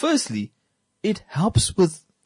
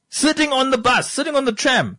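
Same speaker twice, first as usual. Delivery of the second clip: high, disbelieving emphasis; incredulous; 13 semitones higher, 4 dB louder than usual. Real, not acted. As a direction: say it like you can't believe it. Incredulous retelling of kids drinking.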